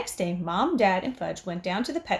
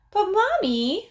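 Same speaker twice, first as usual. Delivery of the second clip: put-on high, slow child plea; boy begging his mother; pleading little boy; 11 semitones higher, 5 dB louder, slower than usual. A narrator's reading. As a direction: draw it out like a whining kid. Fudge pleading with Mom.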